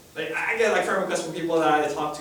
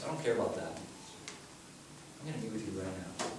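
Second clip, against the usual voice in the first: softer, tender reassurance; gentle Jesus; 5 semitones lower, 6 dB quieter than usual. Acted. Jesus reassures Zacchaeus, softer and tender. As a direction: speak low and kind to the outcast.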